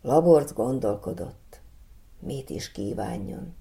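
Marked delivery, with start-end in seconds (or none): 2.2-3.5 musing softly. A narrator's reading. Boy pondering his wish.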